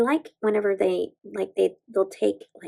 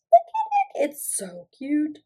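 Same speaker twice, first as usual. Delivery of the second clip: delighted squeal; 6 semitones higher, slower than usual. Real, not acted. Squealing over nail.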